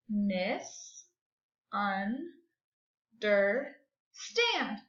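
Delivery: "Misunderstand" very slow, long gaps between four syllables; counted syllables. Shown as a demonstration. Teacher counting syllables for pupils, very slowly split. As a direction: draw out "Misunderstand" piece by piece. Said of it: "That's four syllables".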